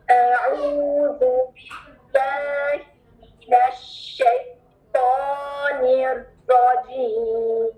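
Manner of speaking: slow drawn recitation in one breath. Shown as a demonstration. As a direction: recite it whole without a break. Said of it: "एक सांस में इसकी practice करनी है". (एक सांस में तिलावत)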